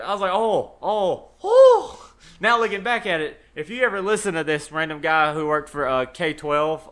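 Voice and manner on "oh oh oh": rising laughing oh oh oh; cracking up mid-exclamation; laughing mock oh. Friend retelling his own shocked reaction, laughing.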